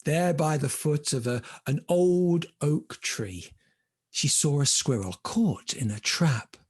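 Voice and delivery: plain reading voice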